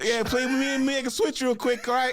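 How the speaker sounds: squeaky high, hammed-up begging to switch; loud, high mock-urgent request; high excited pleading. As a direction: pitch way up and plead like a desperate wingman. Acting out pushy switch request.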